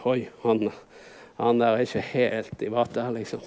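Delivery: low, soft gossiping aside; wary aside. Acted. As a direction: voice an onlooker's discreet doubt, low and soft. Acts out passers-by's wary aside.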